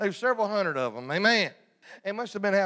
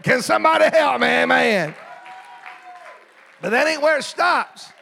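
loud, ringing preacher's call, much louder; full-force preaching; 3 semitones higher, 10 dB louder than usual. Real, not acted. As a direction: preach it out to the whole church, loud.

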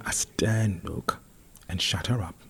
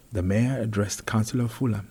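ordinary delivery, nothing marked

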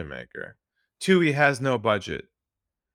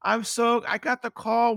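much higher, hammed-up thrill; mock giddy excitement; 10 semitones higher than usual. Salesperson telling his wife about the call, mock-giddy. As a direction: gush like you just landed the sale. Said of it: "You're like".

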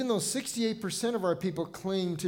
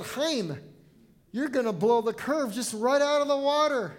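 Chaim and the water line called out high and loud, in playful alarm; mock exasperated outcry; 7 semitones higher, 5 dB louder than usual. Pastor recalls teasing his seminary friend, in a loud playful outcry. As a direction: tease your classmate like he's ruining it for everyone. Teasing a classmate in Hebrew class.

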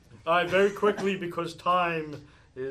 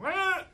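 put-on child's happy squeal; 6 dB louder than usual. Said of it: "And she's going".

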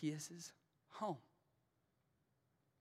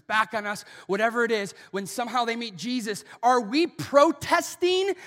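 high, loud, fast insistent promise; loud rapid sermon proclamation; preaching at full force; 9 semitones higher, 16 dB louder than usual. Acted. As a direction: drive the promise home hard.

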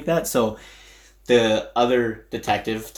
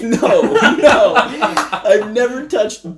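loud, high, laughing mock panic; frantic denial; 8 semitones higher, 6 dB louder than usual. Suspect denying haircutting to detectives, retold frantic denial.

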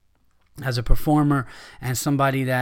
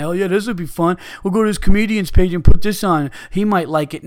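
higher, louder, gleeful sneering impression; mock spammer voice; 4 semitones higher, 7 dB louder than usual. Mocking the trolls who post porn, higher gleeful sneer. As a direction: ham up a smug troll's glee.

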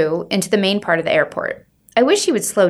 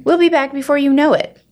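higher, louder chirpy promise; upbeat stepmother; 8 semitones higher, 4 dB louder than usual. Acted. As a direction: reassure brightly. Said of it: "says Chloe".

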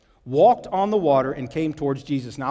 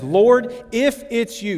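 raised voice, doubt weighted on IF; 6 semitones higher, 4 dB louder, slower than usual. Acted. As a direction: challenge who it is, higher and firm. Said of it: "Do you hear the doubt in his voice already?".

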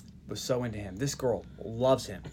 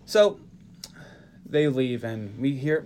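normal talking voice

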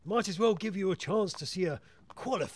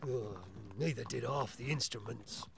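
hushed, low punchline; confidential whispering; 4 semitones lower, 7 dB quieter than usual. Acted. Inspector shares scandal about housekeeper's theatre trip, hushed. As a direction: finish the gossip softly and discreetly. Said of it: "What are we whispering about?".

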